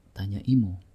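ordinary delivery

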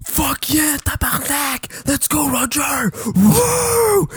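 put-on high, whooping drunk fan; drunken cheering. Drunk fan next to him at the concert, whooping. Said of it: "Kevin was like".

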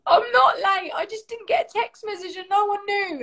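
high-pitched indignant retort; retold flustered self-defence; defensive protest. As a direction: protest as if wrongly accused. Retells defending herself when accused.

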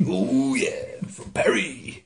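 gravelly whispered wrestler growl, slowed; mock Macho Man whisper-growl; Macho Man impression. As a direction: channel Randy Savage's raspy drawl. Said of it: "You sounded like Macho Man there".

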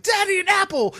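put-on high, loud child's call to dad; imitated excited kid. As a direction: shout it like an eager little kid. Three-year-old calling out in store.

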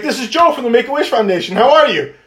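high, fast, eager phone intro; high-energy intro. Retold or imitated. Introducing himself to a wary mom, high energy and fast. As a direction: rush the hello with a big grin. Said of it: "I come high energy".

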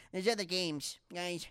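high-pitched mock complaint; mocking Cool Me. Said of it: "this is what Cool Me sounds like".

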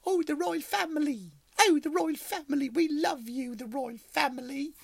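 swooning royal fans. Retold or imitated. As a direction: coo over the royals like a starstruck fan. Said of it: "now all of a sudden we're like".

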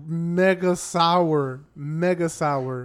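MEGA SOUR stretched out very slowly; drawn-out emphasis. Real, not acted. Telling friends about the yogurt, "mega sour" drawn out slowly. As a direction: drag out each word of "mega sour". Stressing how sour it tasted.

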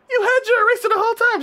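very high, fast incredulous squeal; mock outraged disbelief. Boy finds she had her eraser, incredulous squeal. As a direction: act out his squeaky accusation in a rush. Boy discovers he was tricked.

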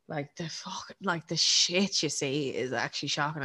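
hushed, breathy whisper on the swear; whispered aside. Real, not acted. To her co-host about street harassment, whispered. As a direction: drop to a whisper, scandalised.

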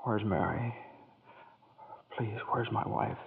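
soft, slow desperate asking; broken, quiet plea. Acted. George softly begging Clarence to tell where Mary is. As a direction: beg gently, almost breaking.

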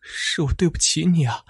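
high, strained, near-tearful voice; acted tearful confession; anguished apology. A narrator's reading. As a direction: force out the apology on the verge of crying. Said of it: "艰难地摇了摇头".

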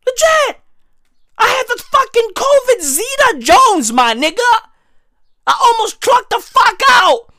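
high-pitched mock outrage yelling; high rant. Comic yelling up high at the crowd about nearly dying. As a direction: squeal it high like a wild rant.

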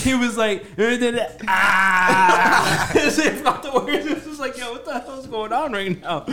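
laughing. Real, not acted. Laughing while retelling.

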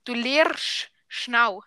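emphatic, drawn-out Swiss German. Shown as a demonstration. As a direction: stretch each dialect word for a learner. Very slow repeat for learner.

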